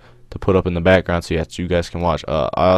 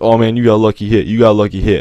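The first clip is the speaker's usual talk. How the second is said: louder, higher mocking friends; retold trash talk; 3 semitones higher, 8 dB louder, faster than usual. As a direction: imitate the friends talking smack. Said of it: "start talking mad crap to me".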